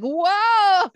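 high, loud stoner-surfer whoa; surfer dude. Retold or imitated. Guy miming surfing at the Californian, high loud surfer whoa. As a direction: ride an imaginary wave with a big whoa. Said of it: "like, to do surfing".

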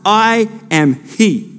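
slow, solemn scripture reading. Acted. Pastor reading God's promise from Isaiah, slow and solemn. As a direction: let each promise land slowly.

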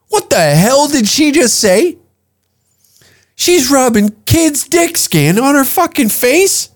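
high-pitched, scandalized disbelief; mock incredulity. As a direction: react as if you can't believe what she said. Acted shock at celebrity's claim.

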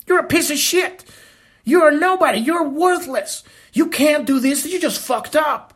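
sneering bully. Retold or imitated. Voicing the abuser again.

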